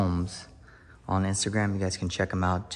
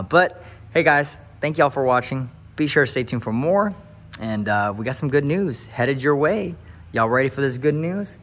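louder, rising preacher call; rally the viewers like a pulpit; preacher hype; 5 semitones higher, 6 dB louder than usual. Acted. Husband hyping viewers toward the good news, preacher style.

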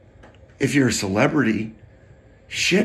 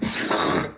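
mock gasp; 9 semitones lower than usual. Reacting to phone news.

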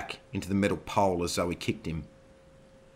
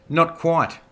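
defiant; 10 semitones higher, 8 dB louder than usual. Acted. Zoe defiant reply.

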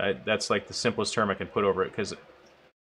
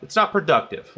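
mock frustrated guy; 6 semitones higher than usual. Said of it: "You're just like".

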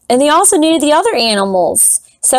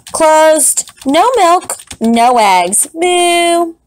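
higher, cheeky strike-notice voice; cows' note; 6 semitones higher, much slower than usual. Acted. Cows tell the farmer they are closed, cheeky and high. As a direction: post it like proud striking cows.

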